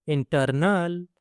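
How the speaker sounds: Internal slow, with a long final "nal"; slow teaching repeat of internal; drawn-out repeat. Shown as a demonstration. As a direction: stretch out internal for learners. Word model, then drawn-out repeat.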